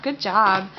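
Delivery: ordinary delivery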